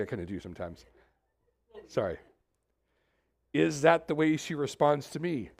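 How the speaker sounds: usual speaking voice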